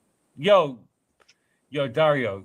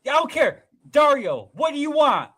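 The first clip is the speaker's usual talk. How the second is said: shouting at Dario; 12 semitones higher than usual. Real, not acted. Yelling again at Dario.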